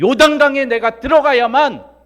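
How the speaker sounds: high, very loud sustained cry; forceful preaching shout. Real, not acted. Preacher pressing a point on the congregation, shouted high. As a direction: belt it out to the congregation.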